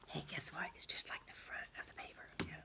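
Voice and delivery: breathy conspiratorial whisper; stage whisper. Acted. Teacher whispering a secret to the class.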